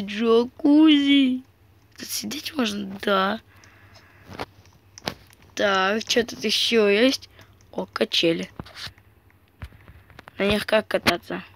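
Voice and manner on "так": ordinary talk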